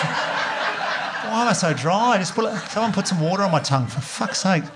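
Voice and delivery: high, dragged-out pleading whine; slow, high plea from a dry throat; parched hungover croak. Pretend. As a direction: beg for water like you're dried out. Hungover man begging for water.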